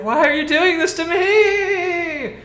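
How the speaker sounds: high, slow, loud drawn-out wail; whiny wail. Acted. The thug wails at the party in a high, slow whine. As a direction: plead like a put-upon victim. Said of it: "She's like".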